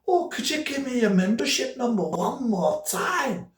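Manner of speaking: high, put-on Scottish woman; chatty phone operator with a hammed-up Scottish accent; broad Scottish accent. Sky operator makes small talk with caller, broad Scottish accent. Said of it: "She had a, a broad Scottish accent".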